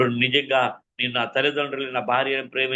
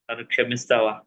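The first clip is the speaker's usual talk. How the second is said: low, broken plea for forgiveness; husband at wife's feet, lower and broken, begging forgiveness; tearful plea; 4 semitones lower than usual. Retold or imitated. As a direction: beg your wife to forgive you. Begging wife at her feet.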